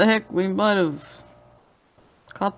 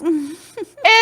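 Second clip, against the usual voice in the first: laughing; 10 semitones higher, 5 dB louder than usual. Real, not acted. Laughing at the bit.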